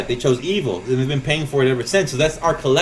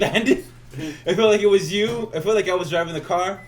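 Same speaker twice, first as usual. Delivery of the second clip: ordinary delivery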